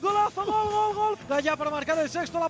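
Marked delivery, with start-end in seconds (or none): none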